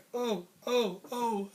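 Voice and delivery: small high cries, as if taking hits; imitated pained ohs. Boxer taking shots in the retelling, high mock yelps.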